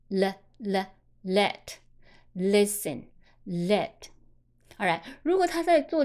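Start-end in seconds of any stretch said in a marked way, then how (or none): 0.1-3.9 "let" and "listen" starting on a clear "le" L; clear L sound. Shown as a demonstration. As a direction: sound the L fully before the vowel in "let", "listen". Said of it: "跟别人的相拼的时候发了". (“let”“listen”开头的L发“了”音)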